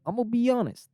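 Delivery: earnest Italian caricature; Italian accent. Acted. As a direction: confess in a comic Italian accent. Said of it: "it was like this".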